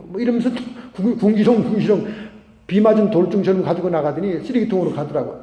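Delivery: usual speaking voice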